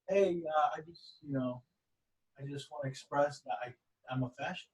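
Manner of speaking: soft, hesitant mock confession; timid. Young man confessing his views to a stranger, timid. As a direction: imitate a sheepish admission, very quietly. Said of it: "a little timid".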